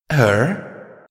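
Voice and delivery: usual speaking voice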